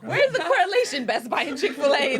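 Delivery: loud, high, amused objection; real laughing disbelief, pitched high; laughing protest. Real, not acted. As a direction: demand an answer while cracking up.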